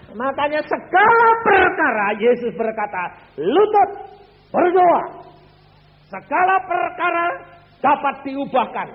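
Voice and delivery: high, loud climax of the sermon; loud preaching. Real, not acted. Preacher proclaiming to the congregation, high and loud. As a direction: preach it out with full force.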